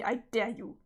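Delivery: usual speaking voice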